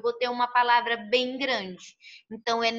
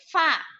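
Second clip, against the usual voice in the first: "Fá" higher and punched out alone; stressed syllable isolated; 5 semitones higher, 4 dB louder than usual. Shown as a demonstration. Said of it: "Qual é a sílaba tônica?". ("Fá" destacado, mais agudo, como sílaba tônica)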